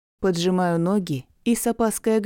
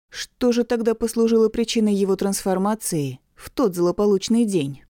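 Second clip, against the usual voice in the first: ordinary delivery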